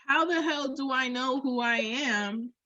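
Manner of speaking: ordinary talk, nothing marked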